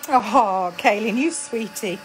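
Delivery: loud, lifted, affectionate "oh"; delighted gushing. Real, not acted. Crafter to a kind viewer, delighted gushing. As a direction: gush fondly at a kind compliment.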